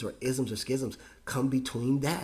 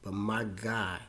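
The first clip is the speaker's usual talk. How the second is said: ordinary talk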